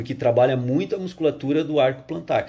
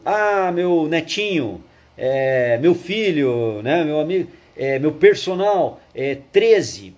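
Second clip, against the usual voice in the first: slow, drawn-out grandpa voice; much slower than usual. Acted. Grandpa answering his trainer's question, slow old-man voice. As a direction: play an old grandpa searching slowly for the answer. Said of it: "vovô, já treinei ele, qual o número?".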